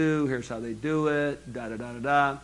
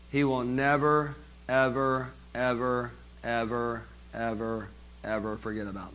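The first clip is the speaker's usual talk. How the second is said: each ever softer and lower until almost a murmur; fading repetition; 3 semitones lower, 6 dB quieter than usual. Shown as a demonstration. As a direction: let the evers sink away into a quiet, lower voice. Emphatic networking promise, fading.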